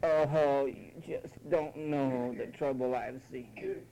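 slow, self-pitying moan, hammed-up; mock tearful lament; crackling cry. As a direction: bleat it out like someone begging for pity. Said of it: "always have that bleeding, crackling cry".

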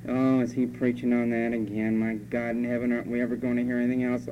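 low, fast monotone grumble; muttered gripe about the preacher; grumbling. Shown as a demonstration. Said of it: "that's grumbling".

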